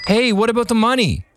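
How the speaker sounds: calling out. A narrator's reading. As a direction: shout after him, baffled. Barber calls after fleeing man.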